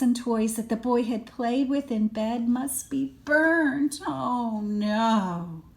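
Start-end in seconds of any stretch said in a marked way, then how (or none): none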